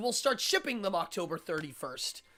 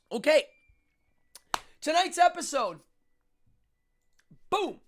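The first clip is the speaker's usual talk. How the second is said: high, loud show intro; excited announcer; 10 semitones higher, 9 dB louder than usual. Acted. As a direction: announce the episode with a bang.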